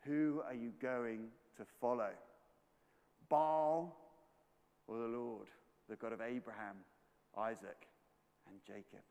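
low, measured preacher's question with long pauses; solemn challenge. Acted. Preacher asking who they will follow, solemn challenge.

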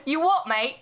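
mock-aggressive Chav challenge; Chav accent. Echoing a friend's angry-cat bit, laughing Chav accent. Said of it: "I'm good at a frickin' Chav accent".

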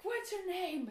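high, indignant retold demand; angry demand. Retelling her confrontation with the pool worker, angrily. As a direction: demand it, re-enacting the anger. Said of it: "angry as hell".

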